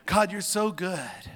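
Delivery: fervent praise. Real, not acted. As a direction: lift the voice high in praise. Spontaneous praise to God, repeated.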